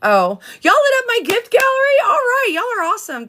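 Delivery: high, delighted burst for the gifts; thrilled thanks, way up in pitch; excited squeal. Real, not acted. Streamer thanking viewers for lit-up gift gallery, high excited squeal.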